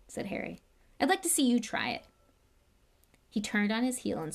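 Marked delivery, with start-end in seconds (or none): 1.0-2.0 louder, higher bitter challenge; angry challenge. Acted. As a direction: throw it at them and leave. Harry angrily storming off.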